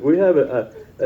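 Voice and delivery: normal talking voice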